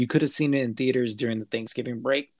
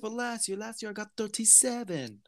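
badly imitated English child's voice; bad English accent; 10 semitones higher than usual. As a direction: do a hammed-up English accent, pitched way up. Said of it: "my best, uh, rendition of an English accent".

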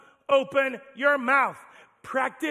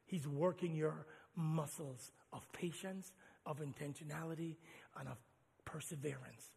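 soft, low, intimate exhortation; quiet preaching; 9 semitones lower, 15 dB quieter than usual. Real, not acted. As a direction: lean in and plead gently.

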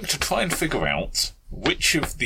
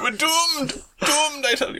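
high wailing cry of doom; melodramatic doom cry. Pretend. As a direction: ham it up, all is lost. Hammed-up despair at the dice.

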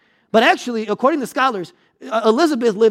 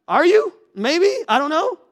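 higher-pitched, hesitant imagined reply; mock awkward uncertainty; 7 semitones higher than usual. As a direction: play a touch of sheepish doubt, pitched up. Acted awkward exchange in imagined visit.

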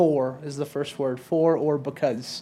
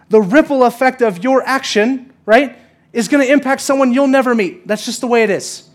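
rising from plain teaching to a louder, higher preach; building preaching; 6 semitones higher, 7 dB louder than usual. Acted. Pastor to congregation, building to a louder preach. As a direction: press the point harder line by line, Boston accent never used here.

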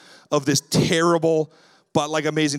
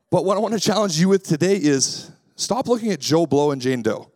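ordinary talk, nothing marked

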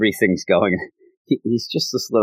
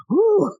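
contented whoo. Retold or imitated. God lounging after big meal.

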